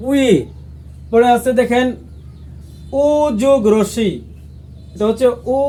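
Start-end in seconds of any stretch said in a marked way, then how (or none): 0.1-0.3 উই glided from u into i; short glide উই; Korean vowel wi. Shown as a demonstration. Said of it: "তাহলে এটা কি হয়?".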